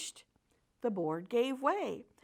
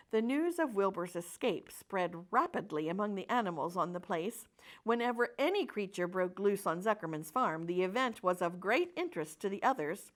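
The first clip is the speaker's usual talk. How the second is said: usual speaking voice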